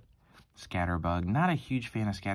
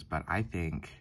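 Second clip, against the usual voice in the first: ordinary delivery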